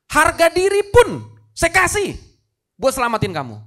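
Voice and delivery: loud, high, fervent sermon delivery; impassioned preacher at full pitch; full-force preaching. Real, not acted.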